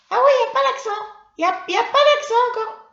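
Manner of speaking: put-on very high, loud childlike squeal; loud, high, eager puppet; excited puppet pupil. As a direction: blurt out a discovery at the top of a little voice. Puppet pupil spots the rule.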